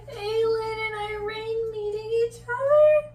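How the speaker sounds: high, loud, tearful gush; tearful squeal. Real, not acted. Reader crying with joy as Aylin and Irene meet, high and tearful. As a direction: near tears with joy, voice up high.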